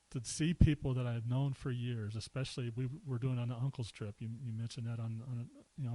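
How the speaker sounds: usual speaking voice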